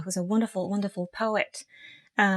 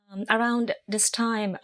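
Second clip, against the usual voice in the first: ordinary delivery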